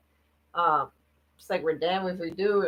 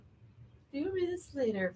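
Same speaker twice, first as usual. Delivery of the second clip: slow, soft, higher put-on voice; Priscilla voice; 5 semitones higher, 12 dB quieter, slower than usual. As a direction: imitate Priscilla softly, drawing it out. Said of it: "where I did my voice".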